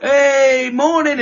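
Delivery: high, bright-pitched mock hello; squeaky cartoonish hey morning; chirpy greeting. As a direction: sing out a cheery morning. Cheery phone greeting imitated.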